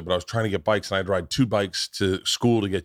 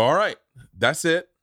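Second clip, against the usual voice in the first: stern dad; 6 semitones higher than usual. Retold or imitated. Voicing kicking kid out of nest.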